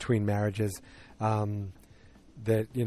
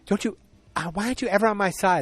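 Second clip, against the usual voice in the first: higher-pitched, lightly acted complaint; mock complaining wife; 8 semitones higher, 4 dB louder, faster than usual. Recapper voicing a wife in a quarrel, mock complaint. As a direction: play a wife protesting to her husband.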